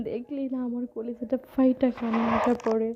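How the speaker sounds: usual speaking voice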